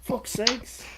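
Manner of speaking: high exasperated Australian curse; Australian accent, fed up. Retold or imitated. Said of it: "[name] can do a really good, uh, Australian accent".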